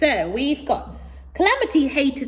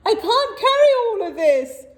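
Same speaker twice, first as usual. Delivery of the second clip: moaning camel; 12 semitones higher, 6 dB louder than usual. Acted. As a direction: moan like a spoilt camel. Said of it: "said Calamity".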